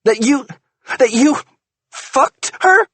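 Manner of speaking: high, loud, halting accusation; stammered shout; furious disbelief. Acted. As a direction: spit out the question in rage. Wife confronts cheating husband.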